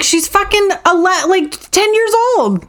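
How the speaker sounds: high, incredulous and appalled; indignant outburst. Retold or imitated. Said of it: "I'm like".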